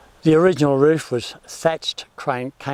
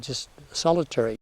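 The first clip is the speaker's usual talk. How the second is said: normal talking voice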